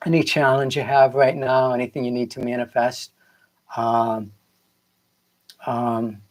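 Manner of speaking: usual speaking voice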